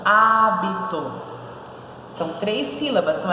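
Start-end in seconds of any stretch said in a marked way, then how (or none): 0.1-1.1 hábito slowly, há, bi, to; split into syllables. Shown as a demonstration. Teacher separating the word for the class, hábito in slow syllables. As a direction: stretch hábito apart syllable by syllable.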